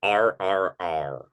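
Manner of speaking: drawn-out American "R R R", said slowly; a lightly American "R R R"; American accent. Shown as a demonstration. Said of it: "Did you say R R R in an American accent?".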